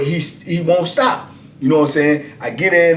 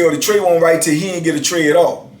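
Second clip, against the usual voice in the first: ordinary delivery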